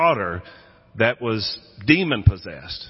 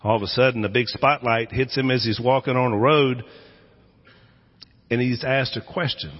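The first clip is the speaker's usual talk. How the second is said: usual speaking voice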